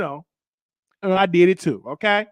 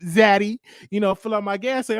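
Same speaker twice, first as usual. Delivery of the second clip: flirty ask; 6 semitones higher, 3 dB louder than usual. Retold or imitated. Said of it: "flirted with me a little bit and say".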